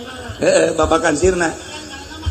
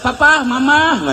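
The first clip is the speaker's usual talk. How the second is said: mock sweet call; 7 semitones higher than usual. Mocking couples sweet in public.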